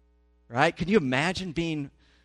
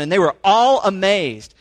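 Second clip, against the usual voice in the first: all punched hard and much louder; stress on all; 5 semitones higher, 10 dB louder than usual. Shown as a demonstration. Pastor stressing that everyone was amazed, heavy stress on all. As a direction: lean hard on all in "And they were all amazed.". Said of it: "that all is emphatic in the text".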